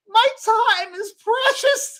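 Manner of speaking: high, loud, slow, laughing; laughing mock haughty. Retelling his retort to Oprah, laughing mock grandeur. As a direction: declare it grandly through laughter. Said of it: "I said".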